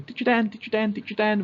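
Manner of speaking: high, insistent repeated calling; whiny child calling out. Shown as a demonstration. Kid interrupting Teacher Dan, high insistent calling. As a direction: pester the teacher like an impatient kid. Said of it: "instead of going".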